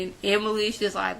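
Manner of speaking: usual speaking voice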